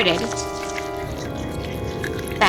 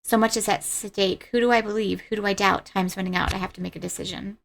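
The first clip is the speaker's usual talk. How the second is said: quiet game narration; 5 dB quieter than usual. Acted. Reads game text quietly.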